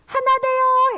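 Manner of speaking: 높고 크게 외치는 시범; 아이들 따라하게 크게 외쳐; calling out. Shown as a demonstration. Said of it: "시야 한번 해보세요". (높고 크게 외치기)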